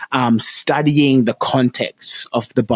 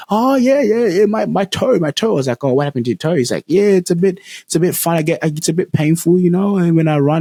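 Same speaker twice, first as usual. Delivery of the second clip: higher-pitched imitation of a joking lad; jokey teenage boy; 6 semitones higher than usual. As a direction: play the boy's jokey reply, pitched up. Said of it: "he was kinda being a bit, like, jokey".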